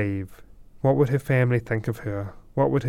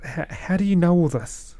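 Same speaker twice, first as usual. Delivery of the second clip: higher, stammering astonishment; stunned disbelief; 6 semitones higher than usual. A narrator's reading. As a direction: stumble over it, amazed. Bewildered Olivia asks stranger.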